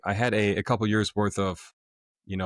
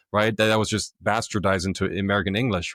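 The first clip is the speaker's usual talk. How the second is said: ordinary talk, nothing marked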